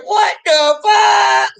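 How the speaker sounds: high, slow, disbelieving yell; stunned shout. Retold or imitated. Shouting in disbelief as the car roars to life.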